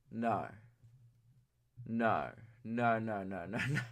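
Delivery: low, hushed, sinking string of no's; dismayed denial. Retold or imitated. Podcaster retelling his reply to best mate, low dismayed denial. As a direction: say it low and soft in dread. Dreading friend's answer in retold exchange.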